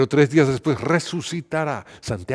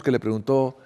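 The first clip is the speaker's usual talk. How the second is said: plain reading voice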